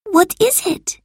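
put-on high-pitched, sleepy child's voice; sleepy boy. A narrator's reading. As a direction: voice a child just woken, pitched well up. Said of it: "said Marcus sleepily".

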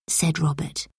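usual speaking voice

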